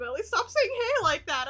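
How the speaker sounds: squeaky loud complaint; protest, pitch high. Real, not acted.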